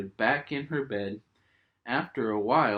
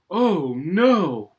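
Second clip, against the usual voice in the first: high, loud startled "oh no"; a child's sudden alarm; gasping little cry of surprise; 6 semitones higher, 8 dB louder than usual. Acted. Peppa startled by falling tooth.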